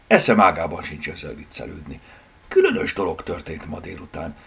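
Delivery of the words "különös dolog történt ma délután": ordinary delivery, nothing marked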